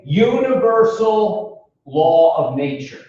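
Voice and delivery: slow, deliberate quoting with pauses between phrases; slow recitation. Shown as a demonstration. Lecturer quoting the wordy categorical imperative to class, slowly. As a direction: recite it phrase by phrase, stretching key words. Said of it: "I'm gonna say it slowly".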